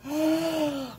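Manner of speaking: high, voiced gasp of amazement; astonished gasp. Real, not acted. Unboxer opening another package, high astonished gasp.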